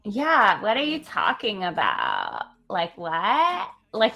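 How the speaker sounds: light mock Malibu accent, drawn-out valley drawl. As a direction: toss it off like a laid-back Malibu local. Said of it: "that's my Malibu accent".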